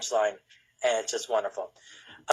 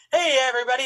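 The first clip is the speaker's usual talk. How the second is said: higher-pitched mock radio DJ hail; 11 semitones higher, faster than usual. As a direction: greet the crowd like a radio DJ. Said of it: "talking like a radio D J".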